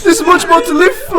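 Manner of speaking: high, laughing, half-crying wail; mock tearful laughing. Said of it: "He's like".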